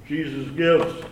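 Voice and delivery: usual speaking voice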